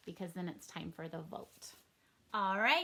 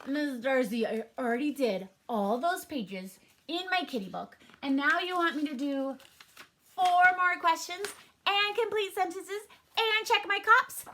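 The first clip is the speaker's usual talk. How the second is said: put-on complaining kid voice, rising higher and louder; building childish protest, higher and louder; whiny student; 6 semitones higher, 7 dB louder than usual. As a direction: whine like a pupil buried in homework.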